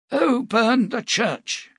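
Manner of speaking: put-on slow, high, firm old prophet; strong firm old voice. Cade questioning the captain, strong and firm. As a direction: demand it, old but unbowed. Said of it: "his voice still strong and firm".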